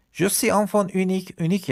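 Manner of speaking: Je suis enfant unique lifted high and slowed; high bright model. Shown as a demonstration. Teacher to class, high slow repeat. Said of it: "model repeated high".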